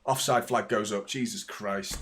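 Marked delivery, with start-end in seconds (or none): 1.1-2.0 soft, falling exclamation, still voiced; quiet relief. Real, not acted. Fan reacting to the offside flag, quiet relief. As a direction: let it drop softly under the commentary.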